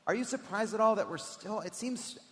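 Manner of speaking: normal talking voice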